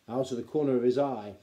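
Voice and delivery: usual speaking voice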